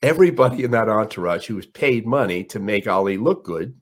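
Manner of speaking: ordinary delivery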